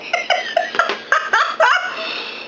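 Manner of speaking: high, breathy laugh of joy; joyful giggle. Real, not acted. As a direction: let a happy laugh spill out. Overjoyed tasting sushi.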